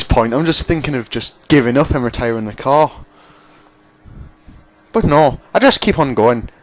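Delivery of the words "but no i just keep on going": badly faked Irish accent, pitched up and louder; awful Irish accent. Gamer joking about not giving up, awful Irish accent. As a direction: put on a bad Irish lilt. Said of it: "that was an awful Irish accent".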